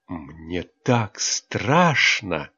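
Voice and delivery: very slow, choked-up voice; strained, choked. Acted. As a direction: squeeze the words out, afraid. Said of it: "сдавленно ответила Хел". (сдавленно, очень медленно)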